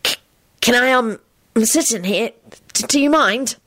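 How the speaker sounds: put-on high, halting boy with stammers; nervous. A narrator's reading. Shy boy asks to share the compartment, nervous. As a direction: stammer it with anxious gaps. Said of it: "he said nervously".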